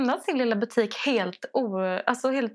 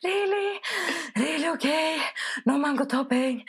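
put-on higher, lightly accented insisting questions; imitating the shop lady; 6 semitones higher than usual. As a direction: press her doubtfully in broken English. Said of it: "Hon bara". (härmar kvinnan, lätt brytning, högre röst)

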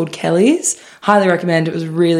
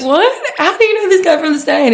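high, loud disbelief; mock shock; 11 semitones higher, 3 dB louder than usual. Retelling the friends' shocked question, high and loud. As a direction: act out the group's shock. Group's surprised reaction.

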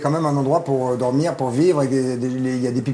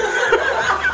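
laugh. Real, not acted. Artist admits she makes penises.

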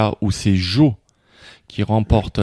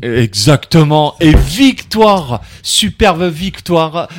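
louder, slowed-down winner's announcement; announcer hype; 3 semitones higher, 7 dB louder, much slower than usual. Real, not acted. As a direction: drag out the victory like a ring announcer. Host crowns the winning answer.

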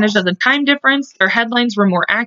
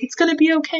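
gently reassuring husband; 7 semitones higher, 3 dB quieter, faster than usual. Retold or imitated. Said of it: "he's like".

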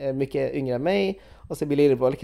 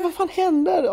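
panicked. Retold or imitated. Panicked reaction retold.

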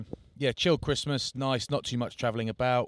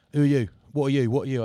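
louder, menacing demand; aggressive bar challenge; 4 semitones higher, 7 dB louder than usual. Retold or imitated. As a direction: bark it like a bloke itching for a fight.

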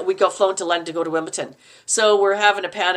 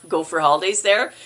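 usual speaking voice

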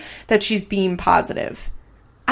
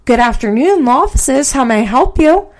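higher, louder receptionist greeting; work phone voice; 5 semitones higher, 6 dB louder than usual. Shown as a demonstration. Law office worker answers a caller in put-on phone voice. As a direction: answer like a polite front desk, lifting the ends. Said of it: "do my voice when I pick up the phone".

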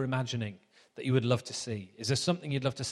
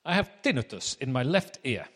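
put-on comedy German accent. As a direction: put on a hammed-up German professor. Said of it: "And he says".

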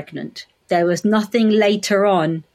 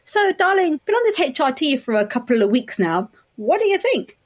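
high, lilting sweet-talk to a husband; coaxing high-pitched wifely voice; wife asking husband; 7 semitones higher than usual. Retold or imitated. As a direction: play up the expectant question, pitched well up. Retold question to her husband.

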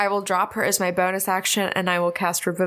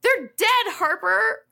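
exasperated shout; 12 semitones higher, much slower than usual. Acted. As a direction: yell it at him. Character shouting the news.

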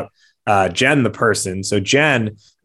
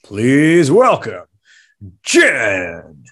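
boxing ring announcer; 3 semitones higher, 3 dB louder, slower than usual. Acted. Ring-style guest introduction.

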